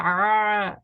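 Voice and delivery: ah tight and constricted in mid throat; throat ain demo. Shown as a demonstration. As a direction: squeeze the ah in the throat. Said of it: "You should feel that".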